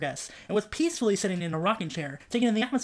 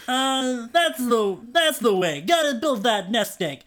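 dopey guy voice; 4 semitones higher, 4 dB louder, slower than usual. A narrator's reading. Dopey brother voiced in story.

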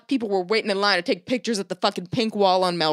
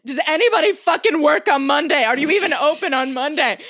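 incredulous yell; 6 semitones higher, 4 dB louder than usual. Retold or imitated. Angry at clerks, retold.

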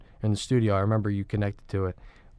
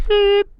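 sharp high beep sound; shrill vocal censor beep; mouth bleep; 10 dB louder than usual. Pretend. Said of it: "What the hell was that?".

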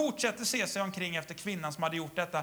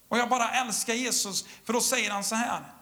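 normal talking voice

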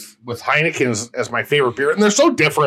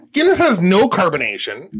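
normal talking voice

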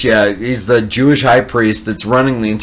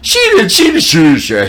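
high, loud mock crowd of women; women tattling; 9 semitones higher, 6 dB louder than usual. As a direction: squeal the accusation in quick repeats. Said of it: "and she goes".

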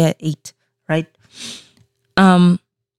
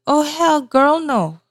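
indignant refusal; 10 semitones higher, slower than usual. Retold or imitated. Reacting to being called sheepish.

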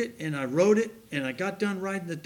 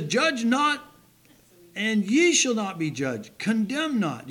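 raised, higher scripture reading; preacher reading the verse out louder; 4 semitones higher, 5 dB louder, slower than usual. Real, not acted. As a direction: proclaim the verse to the congregation. Preacher reads verse aloud.

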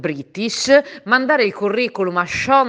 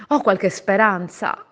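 ordinary talk, nothing marked

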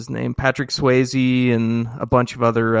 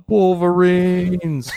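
drawn-out battle cry; yell; 6 semitones higher, much slower than usual. Retold or imitated. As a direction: stretch it into a rally shout. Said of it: "we would all run outside and yell".

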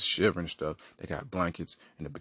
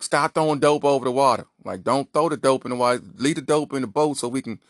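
raised, higher-pitched police order; insistent, loud shouted command; police yelling; 4 semitones higher, 4 dB louder than usual. Acted. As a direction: call out over roaring boat engines. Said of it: "can't really hear each other".

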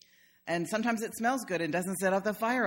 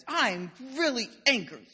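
put-on slightly higher, emphatic little-kid declaration; little granddaughter; 4 semitones higher than usual. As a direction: imitate a small child announcing a big feeling. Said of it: "now she goes".

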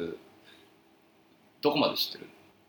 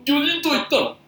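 talking through a burp; burping the words; 12 semitones higher, 3 dB louder than usual. Acted. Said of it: "その喋り方やめろ。".

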